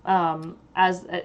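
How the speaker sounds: normal talking voice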